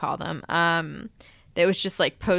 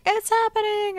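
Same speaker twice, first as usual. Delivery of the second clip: excited quote. Retold or imitated. Quoting co-host's excited post.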